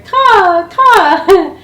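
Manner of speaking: high, loud cawing like a raven; playful raven imitation. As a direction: call out like a crow. Teller imitates a raven's call.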